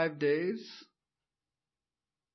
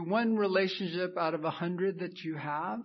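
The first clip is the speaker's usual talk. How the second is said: ordinary talk, nothing marked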